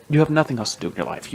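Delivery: rattled-off, exasperated jab; quick scolding. Real, not acted. Podcaster telling off the media, quick exasperated scolding. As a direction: say it fast, fed up.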